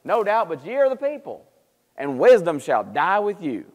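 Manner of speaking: imitated exasperated sarcasm, slowing at the end; sarcastic outburst. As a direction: burst out with exaggerated, frustrated sarcasm. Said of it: "he's being very sarcastic".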